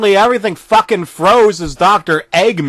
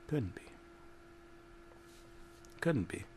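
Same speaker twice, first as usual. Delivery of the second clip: fading denial; 10 semitones higher, 27 dB quieter than usual. A narrator's reading. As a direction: let it die away in disbelief. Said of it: "It couldn't be".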